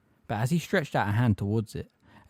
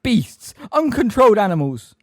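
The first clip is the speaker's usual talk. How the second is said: very loud, high hysterical cries; screaming abuse; 11 semitones higher, 10 dB louder than usual. A narrator's reading. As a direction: scream insults at the top of your pitch. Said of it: "she screamed".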